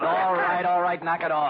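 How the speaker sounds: loud warning. Acted. Sam breaks up the fight.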